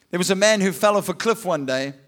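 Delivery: ordinary talk, nothing marked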